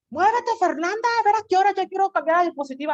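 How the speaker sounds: high, loud, fast pushy scolding; impatient teacher. Acted. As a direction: hurry the student along impatiently. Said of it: "Fernanda está enojada".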